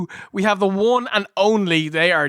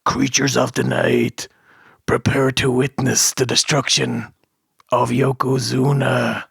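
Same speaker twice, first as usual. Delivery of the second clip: Undertaker impression; 6 semitones lower than usual. Host parodying the Undertaker promo, low and ominous. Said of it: "Taker comes on and goes".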